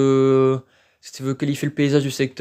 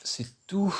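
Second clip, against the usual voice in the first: breathy whispered aside, pitched up; hushed whisper to himself; 6 semitones higher, 8 dB quieter than usual. Real, not acted. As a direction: whisper it under your breath. Reacting quietly while filming.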